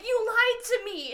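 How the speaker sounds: high, loud, slow accusing; angry accusation. Retold or imitated. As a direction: throw the accusation at him. Quoting her accusing him.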